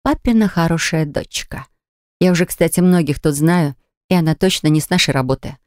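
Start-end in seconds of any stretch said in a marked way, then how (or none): none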